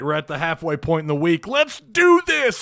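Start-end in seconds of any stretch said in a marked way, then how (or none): 1.5-2.6 high, slow, drawn-out hype call; hype opener. Acted. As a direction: stretch it out like a show intro.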